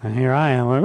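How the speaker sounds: ordinary talk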